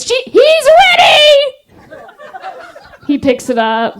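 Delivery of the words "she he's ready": high, loud hammed-up shout; mock shout. Instructor shouting that the army guy is ready. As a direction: yell the announcement, stretched out. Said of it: "And she goes".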